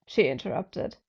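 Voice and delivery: ordinary delivery, nothing marked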